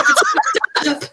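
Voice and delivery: very high-pitched mock yelling; imitated yell. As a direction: squeak out your friend's yell through laughter. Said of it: "She was like".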